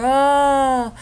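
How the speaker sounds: high, loud, drawn-out "oh"; mock a rider's cry on a roller coaster; roller-coaster whoop. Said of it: "if you're on a roller coaster".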